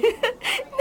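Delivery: high bursting giggle; a giggle that breaks out at her own joke. Real, not acted. Girl giggling at her silly idea about Calvin.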